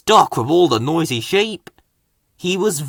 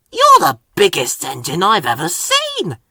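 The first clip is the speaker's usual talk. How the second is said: higher, louder amazed cry; cried out; 6 semitones higher, 3 dB louder than usual. Acted. Thomas marvelling at the giant engine, excited. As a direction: gasp it out in awe. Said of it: "cried Thomas".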